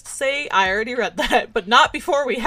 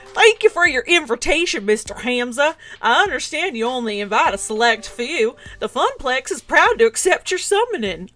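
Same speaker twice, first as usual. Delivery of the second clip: a light, hammed-up southern accent, pitched up; 2 semitones higher, 4 dB louder than usual. Player thanking Hamza for his invitation in a mild southern accent. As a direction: play a courtly southern gentleman, a bit overdone. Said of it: "I don't know why I turned southern there".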